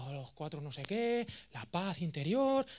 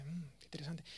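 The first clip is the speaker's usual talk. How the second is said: soft whispered mm, what interesting; intrigued whisper; 13 dB quieter than usual. Retold or imitated. Julio leans in to the speaker, intrigued whisper. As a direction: breathe it out, fascinated.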